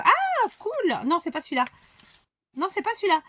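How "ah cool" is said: exclamation aiguë et ravie; s'exclamer de surprise, voix qui monte. Real, not acted. Découvre un élément du colis. (vraie joie de la découverte)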